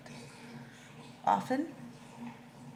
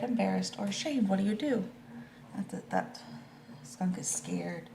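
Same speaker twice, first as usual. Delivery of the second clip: ordinary delivery, nothing marked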